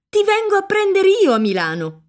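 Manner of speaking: excited pledge, voice lifted on ti; eager promise. A narrator's reading. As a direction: ti lifted high, announcing she will come herself. Agnese promises, then repeats.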